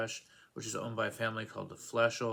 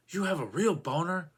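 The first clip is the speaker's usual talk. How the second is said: indignant actress; 6 semitones higher, 7 dB louder than usual. Retold or imitated. Actress complains during film scene.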